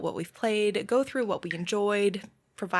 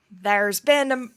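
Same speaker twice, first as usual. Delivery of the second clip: put-on a half-hearted stab at a pirate accent, louder; attempted pirate accent; 6 dB louder than usual. Host's second go at a pirate accent, loud. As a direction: try a pirate accent, break off mid-word. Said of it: "I cannot do a pirate accent with that phrase".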